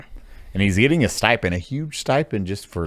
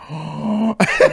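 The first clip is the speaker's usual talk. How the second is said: mock gasp; 13 semitones higher, 6 dB louder than usual. People reacting shocked.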